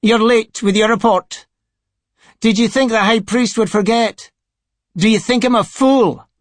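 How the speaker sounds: high-pitched sneer of a sycophant. A narrator's reading. Sycophant scolding Reith over his late report, sneering. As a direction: sneer each question in a high voice. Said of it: "almost sneered".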